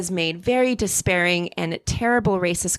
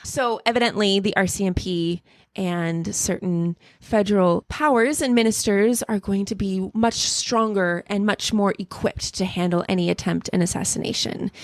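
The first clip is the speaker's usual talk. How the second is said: ordinary talk, nothing marked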